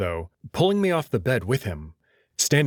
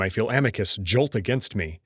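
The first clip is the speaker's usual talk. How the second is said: usual speaking voice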